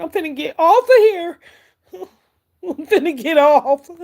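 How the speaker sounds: fighting back real tears; about to cry. Real, not acted. Medium overwhelmed by a spirit mother, voice breaking toward tears. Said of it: "I'm about to start crying".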